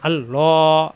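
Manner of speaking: normal talking voice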